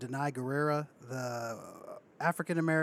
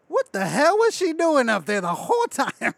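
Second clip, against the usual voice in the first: loud, high exasperated outburst; incredulous raised-voice complaint; mock indignation; 9 semitones higher, 10 dB louder than usual. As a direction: imitate an outraged onlooker, played for laughs. Imitates character's indignant reaction.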